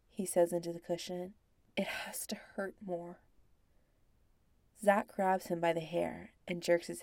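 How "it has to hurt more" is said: breathy pleading whisper. Acted. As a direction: urge it under your breath. Ryan pleads for harder spanking.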